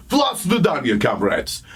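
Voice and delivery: mock heavy Russian accent reading a memo; hammed-up Russian accent. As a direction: read it like a Russian spy. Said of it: "I could only read it in this voice".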